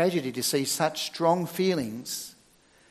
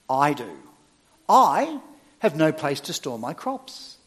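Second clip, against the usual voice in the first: I leaned on and drawn out; stress on I; 5 dB louder than usual. Shown as a demonstration. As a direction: set every I apart with heavy emphasis. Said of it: "gonna emphasise the word I".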